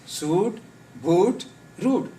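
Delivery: suit, boot, root each with a long oo; long-vowelled suit, boot, root. Shown as a demonstration. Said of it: "long sound".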